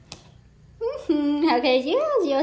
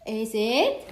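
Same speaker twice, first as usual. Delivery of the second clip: ordinary talk, nothing marked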